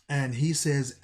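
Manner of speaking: plain reading voice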